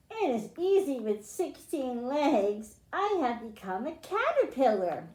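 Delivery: slow, expressive storybook reading; reading to children. Acted. Teacher reading a guess-who book to her class, storybook voice. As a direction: read it like a picture book to little kids. Reads picture book aloud.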